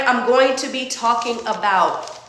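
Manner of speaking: normal talking voice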